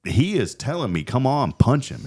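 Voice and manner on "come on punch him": higher, urging retold command; acted goading. Dad telling son to punch him, acted goading. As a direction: egg him on as dad did.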